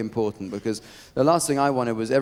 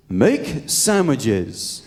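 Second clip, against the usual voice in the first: each word drawn out slow; slow deadpan reading; much slower than usual. Retold or imitated. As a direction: read the card slowly for the punchline. Reading out the card.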